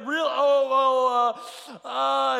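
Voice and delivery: high, loud, broken fumbling syllables; panicked stammer. Retold or imitated. Man frozen on the jump bar before the instructor, high panicked sputter. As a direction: sputter like you're frozen with fear.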